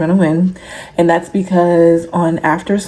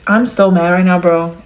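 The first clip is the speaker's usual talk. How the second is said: normal talking voice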